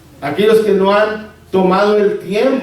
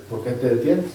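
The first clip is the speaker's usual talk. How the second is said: soft pressing question; 8 semitones lower, 8 dB quieter than usual. Acted. Repeating the question to sinner.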